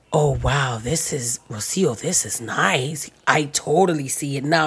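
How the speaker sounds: low, soft, slow awe; impressed man. Retold or imitated. Client imitating Steven seeing the trees, low hushed awe. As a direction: drop low and quiet, slowly won over.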